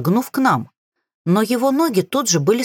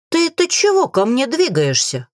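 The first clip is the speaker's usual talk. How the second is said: higher-pitched indignant outburst; indignant growl; 7 semitones higher than usual. A narrator's reading. Witch to the king edging closer, indignant. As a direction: narrator lightly acting an indignant snap. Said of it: "тут же возмущённо рыкнула".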